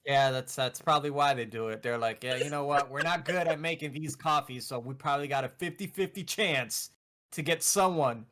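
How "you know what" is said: usual speaking voice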